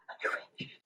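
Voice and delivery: very soft voiceless murmur; inaudible whisper. Acted. Asher holding the star close, secret whisper. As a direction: whisper it so it barely carries. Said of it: "you can't actually hear what she says".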